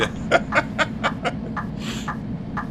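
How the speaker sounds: high breathy burst of laughter. Real, not acted. Laugh after quote.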